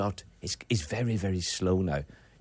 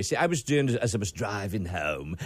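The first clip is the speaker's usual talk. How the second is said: ordinary talk, nothing marked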